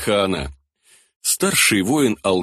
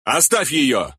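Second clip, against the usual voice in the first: raised commanding shout; 4 semitones higher than usual. A narrator's reading. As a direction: shout the order. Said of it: "выкрикнул Бек".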